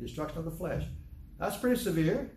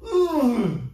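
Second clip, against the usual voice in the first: ooh; 8 semitones higher, 9 dB louder than usual. Real, not acted. Reacting to his own story.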